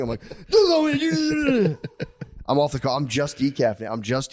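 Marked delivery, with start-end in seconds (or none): 0.3-2.4 high, drawn-out garbled scream; yelling back. Retold or imitated. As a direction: scream back wordlessly, wildly overdone. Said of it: "Will you stop fucking yelling".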